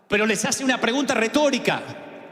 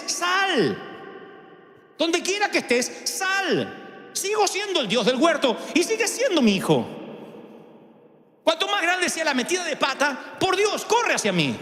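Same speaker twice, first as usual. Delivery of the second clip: higher, gentle fatherly call to come out; God calling his child out of hiding, tenderly; tender invitation; 7 semitones higher than usual. Acted. God's invitation voiced.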